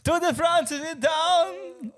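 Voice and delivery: put-on high, slow French accent; hammy French host voice. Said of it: "we can do the accent".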